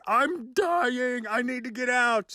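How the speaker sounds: high, desperate cry to get out; panicked plea. Retold or imitated. Choking recruit begging the drill sergeant, high and panicked. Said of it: "and I'm like".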